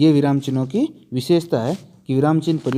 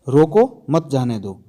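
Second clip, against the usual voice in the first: "रोको" set apart by a pause, then "मत जाने दो" run together; pause after "रोको"; 2 semitones lower, slower than usual. Shown as a demonstration. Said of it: "देखो, रोको के बाद". ("रोको" के बाद विराम, फिर "मत जाने दो" एक साथ)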